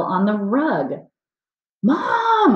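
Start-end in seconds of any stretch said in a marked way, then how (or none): 1.8-2.5 high, loud, drawn-out call; hollering. A narrator's reading. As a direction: yell for Mom at a very high pitch. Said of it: "I hollered".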